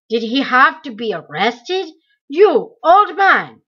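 loud, harsh summons, slowing at the end; calling out. A narrator's reading. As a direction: call the old man forward like an order. Interrogator calling the old man.

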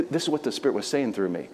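ordinary talk

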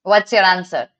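ordinary delivery

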